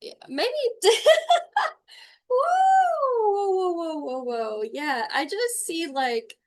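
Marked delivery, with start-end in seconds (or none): 2.3-4.6 long high whoa tumbling down in pitch; high startled whoa. Real, not acted. Medium hit by strong energy, startled descending whoa. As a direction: let out a whooping whoa, then settle.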